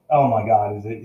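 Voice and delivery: ordinary talk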